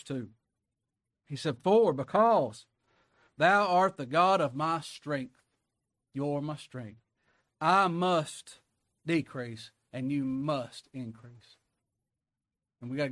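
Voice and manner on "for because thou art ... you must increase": slow, loud, drawn-out preaching; preaching the psalm. Acted. Preacher reading the psalm to his flock, slow and loud. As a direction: lean into every word of the verse. Preaching scripture at full force.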